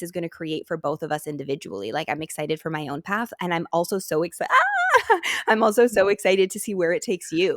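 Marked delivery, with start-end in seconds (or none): 4.5-5.4 sudden high squeal into a laugh; a real emotional laugh that bursts out. Real, not acted. Overwhelmed host breaking into a squealing laugh.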